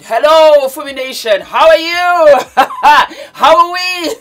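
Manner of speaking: loud, high, laughing greeting; big and loud. Shown as a demonstration. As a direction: belt it out like a hyped host. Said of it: "I'm always big and loud".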